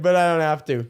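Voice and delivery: chuckling through the quote; laughing. Retold or imitated. Retelling the chef's shrug, laughing through it. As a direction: laugh while repeating the chef. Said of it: "He's like".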